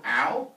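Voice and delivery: loud, short play-acted yelp; mock pained "ow". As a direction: yelp as if poked, for comedy. Stabbed brother cries out.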